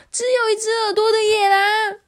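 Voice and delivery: 只有一只耳朵的野狼 shouted high and loud in fright; frightened goat yelling; alarmed shout. Acted. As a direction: cry out 只有一只耳朵的野狼 in a high scared voice. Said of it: "咩妹也在大叫说".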